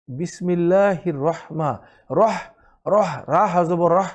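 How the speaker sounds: slow রহ with the ha from the middle of the throat. Shown as a demonstration. Teacher shows the mid-throat ha in রহমান. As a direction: stretch রহমা, press ha from mid-throat. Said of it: "এই হা হচ্ছে গলার মাঝখান থেকে".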